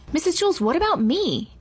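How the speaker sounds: high, insistent demand. A narrator's reading. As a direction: demand to be noticed. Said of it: "Allison demanded".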